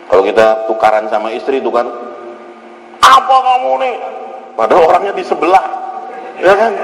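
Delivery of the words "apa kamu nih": put-on marital yell, pitched up; mock angry shrieking. Husband to wife in a quarrel, mock angry yell. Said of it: "kalau marah tuh jerat-jerit".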